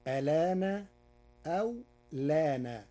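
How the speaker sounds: loud demo of ألان and لان; two Warsh forms. Shown as a demonstration. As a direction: say ألان with hamza, then لان without. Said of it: "فتصير الكلمة هكذا".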